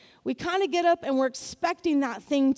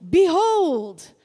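ringmaster's call; 3 semitones higher, 7 dB louder than usual. Retold or imitated. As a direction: announce it to the whole arena. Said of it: "until he said what".

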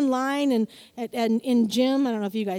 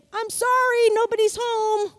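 high, bright brush-off of fear at the door; mock door answer; 7 semitones higher, 4 dB louder than usual. Preacher voicing a brush-off to fear, higher and louder. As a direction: sing it out like nobody's home.

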